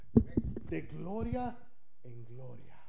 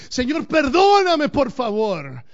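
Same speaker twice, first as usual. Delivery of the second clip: high, loud and slow, crying out; anguished plea; 10 semitones higher, 7 dB louder, much slower than usual. Shown as a demonstration. Pastor shows the congregation how to cry out, anguished plea. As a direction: beg God desperately. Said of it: "nuestro clamor tiene que ser así".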